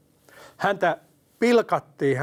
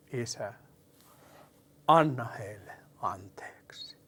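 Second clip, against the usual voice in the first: whispered cry; 4 semitones lower, 5 dB quieter than usual. Retold or imitated. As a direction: breathe the plea out barely voiced. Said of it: "Hän huusi". (hiljainen, hidas kuiskaus)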